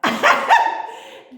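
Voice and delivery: bursting into a loud high laugh; laughing out loud at herself. Real, not acted. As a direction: cackle at the memory. Laughs at her own story.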